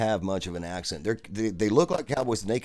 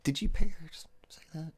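whisper; 4 semitones higher, 6 dB quieter than usual. Real, not acted. Joking aside to cohost.

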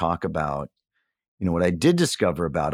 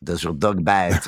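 put-on slow, drawn-out comic French accent; silly French accent. Quoting Inspector Clouseau's film line, silly French accent. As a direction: quote it as a bumbling French inspector. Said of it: "their silly French voices".

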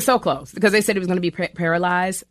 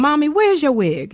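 put-on high child voice, a little louder, rising at the end; little son's voice; 9 semitones higher, 4 dB louder than usual. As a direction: play the small boy again, pitched way up. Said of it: "he said".

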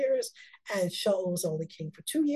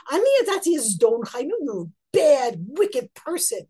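harsh accusation; 4 semitones higher, 6 dB louder than usual. Acted. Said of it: "he says to David very harshly".

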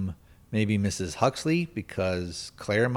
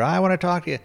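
higher-pitched mock urgent demand; pitched-up imitation of a pleading confrontation; 8 semitones higher, much faster than usual. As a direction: act out someone insisting on being heard. Acting out John confronting the senator.

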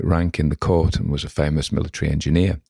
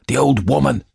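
startled cry, pitched sharply up; sudden high outburst of shocked recognition; involuntary exclamation; 9 semitones higher than usual. A narrator's reading. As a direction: blurt it out high, as if against your will. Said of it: "he exclaimed involuntarily".

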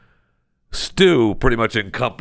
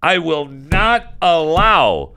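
host vowing to protect ketchup shelves, hammed-up loud; mock vow; 8 semitones higher, 4 dB louder than usual. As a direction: play it like a grandstanding oath.

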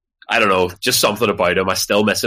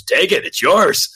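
slow, higher, insistent offer; slow higher "take it" to a stranger; generous offer; 10 semitones higher, 3 dB louder, slower than usual. Retold or imitated. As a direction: push it on him kindly, slowly. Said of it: "And he just goes".

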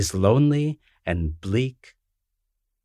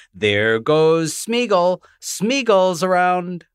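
drawn-out excited call, pitched up; children calling out; 7 semitones higher, 4 dB louder, slower than usual. A narrator's reading. Children on the beach spotting Smeagol, calling out slowly. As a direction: call it out slowly like thrilled kids. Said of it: "all over the town cry".

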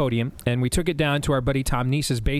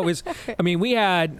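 usual speaking voice

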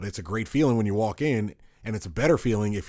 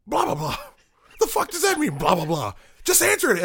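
shrieking way up high and louder, hammed-up anger; mock drunk outrage; 5 dB louder than usual. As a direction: mimic a furious drunk friend in a squeal. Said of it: "drunk Andrew is pissed and drunk".

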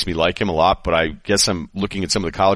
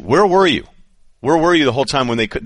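exasperated, higher, rising energy; where were you, in disbelief; incredulous; 6 semitones higher than usual. Real, not acted. Incredulous rant at pundits.

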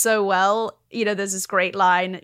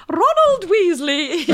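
high, loud, drawn-out scolding of a pupil; Maggie impression; 10 semitones higher, 7 dB louder, slower than usual. Actress imitating Maggie for the host, high drawn-out impression. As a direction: call it out slowly, high and imperious. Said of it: "can you do an impression of Maggie".

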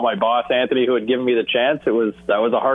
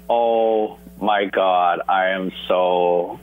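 slow, lower, sheepish apology; embarrassed apology; 5 semitones lower, much slower than usual. Retold or imitated. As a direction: drag it out, mortified. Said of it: "I'm so embarrassed".

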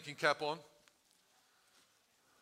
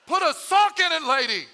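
very high, loud outburst; exasperated shout; 13 semitones higher, 16 dB louder than usual. Acted. Disciples silencing the woman.

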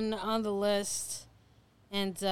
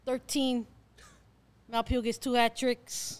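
put-on Chicago accent; 4 semitones higher, 6 dB louder than usual. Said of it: "in our best Chicago accents".

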